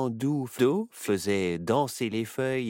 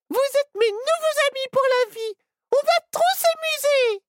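put-on overjoyed little girl; 6 dB louder than usual. Little girl greeting her new friends, squealing with joy. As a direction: bounce with pure joy.

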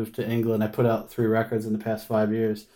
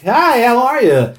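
high, loud, slow overfriendly greeting; friendly official; 12 semitones higher, 8 dB louder than usual. Retold or imitated. Phone official greeting the deported musician, overly friendly and high. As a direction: play the friendliest guy on the phone, pitched way up. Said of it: "not in, like, an awful American accent".

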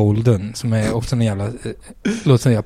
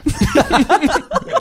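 sudden high giggle; high burst of laughter. Real, not acted. Admitting the bar was free, bursting into high laughter. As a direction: laugh at yourself. Laughs at own shot mistake.

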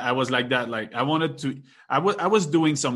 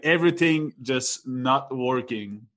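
ordinary talk, nothing marked

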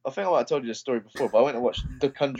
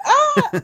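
high, louder mock scream of the audience; 6 dB louder than usual. Cinema audience jumping at a scare, high mock shriek. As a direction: let out a short startled mock shriek. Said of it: "Everyone goes".

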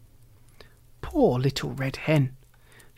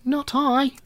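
rat voice; 9 semitones higher than usual. A narrator's reading. Rat refuses in story.

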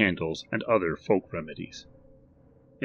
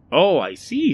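louder, higher shopkeeper; hearty shopkeeper greeting; Barry's eager voice; 9 semitones higher, 8 dB louder than usual. Acted. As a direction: chirp it like a keen salesman. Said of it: "said Barry".

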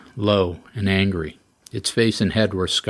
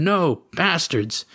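screaming; 8 semitones higher, slower than usual. A narrator's reading. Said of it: "he screamed".

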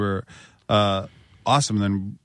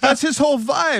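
mock voice. Mocking TJ Miller vibe.

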